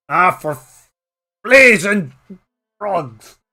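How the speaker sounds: put-on old man's startled oath; startled curse. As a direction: burst out in alarm. Startled exclamation.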